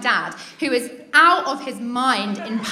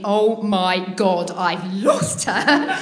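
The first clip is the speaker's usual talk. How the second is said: slow, drawn-out dismay; mock-horrified, word by word; dawning horror; 3 semitones lower, much slower than usual. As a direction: stretch it out as the loss sinks in. Dad realises child is lost.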